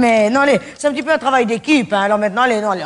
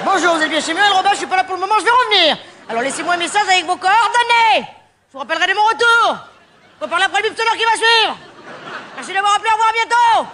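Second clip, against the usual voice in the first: high, tense, irritated greeting; high irritated voicemail greeting scolding callers; telling callers off; 9 semitones higher than usual. Acted. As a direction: bark the greeting a bit annoyed. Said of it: "je les engueule un petit peu".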